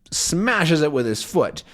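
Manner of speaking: plain reading voice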